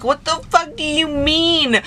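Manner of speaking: high, drawn-out indignant question; heated, incredulous reenacted retort; retold outrage. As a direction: stretch it out in exasperated disbelief. Retold quarrel, she confronts him.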